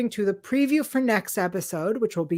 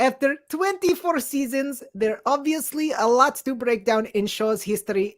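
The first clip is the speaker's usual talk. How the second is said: slightly raised, put-on Russian accent; 2 semitones higher than usual. Co-host reads listener's five-star review, put-on Russian accent. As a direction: read it out in a hammy Russian accent. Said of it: "read it in her Russian accent".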